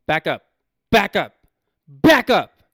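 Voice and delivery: acted warning, escalating to a shout; mock confrontation climbing from firm to yelled; building warning. Acts out pushing the man away.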